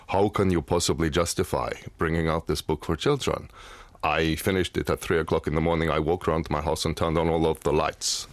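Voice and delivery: put-on low, measured Danish-accented voice. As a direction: imitate a grave Danish journalist's accent. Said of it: "That's a good accent".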